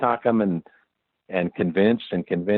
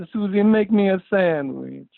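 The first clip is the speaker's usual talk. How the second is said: higher, louder, slow Hungarian accent with the end of the line falling away; Hungarian accent demo; 10 semitones higher, 5 dB louder, slower than usual. Shown as a demonstration. Demonstrating how Hungarian animators talk, accent falling off at the end. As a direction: let "Susie, make me a sandwich." drop off at the end, Hungarian style. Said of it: "it kinda falls off at the end".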